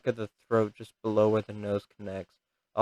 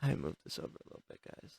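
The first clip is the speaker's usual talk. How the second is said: whispered aside; 7 semitones lower, 17 dB quieter, faster than usual. Real, not acted. Aside to viewers.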